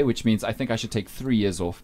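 usual speaking voice

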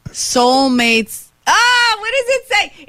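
high, eager recall of the sign; straining to remember, voice jumping up; excited. Real, not acted. Wife excitedly recalling the gift sign.

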